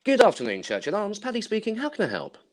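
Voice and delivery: dainty put-on telephone politeness; posh phone voice. Shown as a demonstration. Barman repeating his pub phone greeting on request, high and prim. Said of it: "Can you do that again for me".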